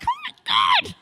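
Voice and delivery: very high mock exasperation; exasperated oh my god. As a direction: squeal it in disbelief. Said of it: "I'm like".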